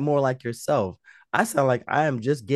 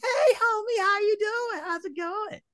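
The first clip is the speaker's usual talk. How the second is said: squeaky put-on greeting. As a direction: greet your co-host in a silly falsetto.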